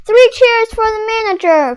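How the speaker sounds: rally the crowd; cheering cry. Acted. Mister Krabs to the crowd, cheering. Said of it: "cried Mister Krabs".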